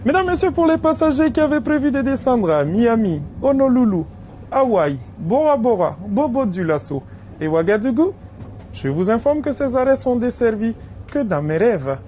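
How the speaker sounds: joking train announcement; announcer voice. Acted. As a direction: deliver it like a conductor's announcement.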